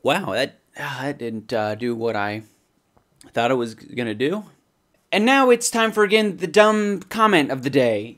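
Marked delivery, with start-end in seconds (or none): 5.1-8.1 high, bright segment announcement; announcer intro. Acted. To viewers, opening the dumb comment segment, announcer voice. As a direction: announce it like a show host.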